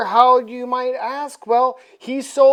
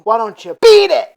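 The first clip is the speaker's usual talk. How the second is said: punchy dismissal; 3 semitones higher, 11 dB louder than usual. Acted. Dracula dismisses the rival.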